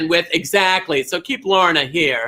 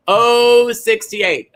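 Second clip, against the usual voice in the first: drawn-out bingo call; 4 semitones higher, 4 dB louder, much slower than usual. Real, not acted. Bingo host calling number.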